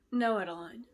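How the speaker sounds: plain reading voice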